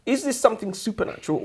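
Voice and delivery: ordinary talk, nothing marked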